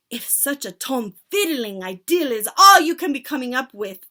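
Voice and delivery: loud furious witch roar; thundering. Acted. Witch leader scolding her followers, loud. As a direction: thunder at them. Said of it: "thundered the Grand High Witch".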